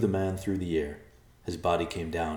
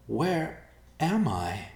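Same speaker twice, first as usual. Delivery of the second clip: confused; 9 semitones higher, much slower than usual. Acted. Dazed girl wakes confused.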